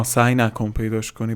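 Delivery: ordinary talk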